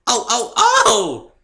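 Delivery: very high, loud, slow excited yips; a giddy pony nearly jumping off the ground; yipping with glee. A narrator's reading. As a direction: bounce with every yip. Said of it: "she yipped".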